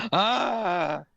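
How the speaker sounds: big performed sigh; huge sigh. A narrator's reading. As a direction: heave an acted, heavy sigh. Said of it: "she heaved a huge sigh".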